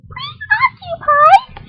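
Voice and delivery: put-on squeaky high eager kid's yes; high girl voice. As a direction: shout it up high like an excited little girl. [name] repeats word in disbelief.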